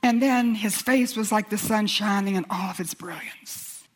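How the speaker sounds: ordinary delivery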